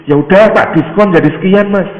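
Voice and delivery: high, loud eager concession; very enthusiastic. Acted. Seller granting the buyer a discount, very enthusiastic. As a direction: offer it with keen seller's zeal. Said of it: "kayaknya semangat sekali".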